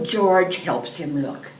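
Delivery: ordinary delivery, nothing marked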